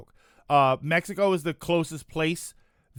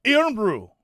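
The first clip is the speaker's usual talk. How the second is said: "Irn-Bru" puffed up and louder in a Scottish accent; Scottish accent attempt; 3 semitones higher, 5 dB louder than usual. Shown as a demonstration. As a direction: chest out, say "Irn-Bru" like a proud Scot. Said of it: "while trying to sound Scottish".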